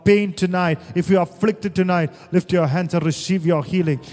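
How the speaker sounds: loud, fervent healing prayer; preaching at full force. Acted. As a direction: preach it out with fire.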